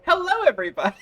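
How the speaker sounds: excited greeting. Real, not acted. Stream host greets chat.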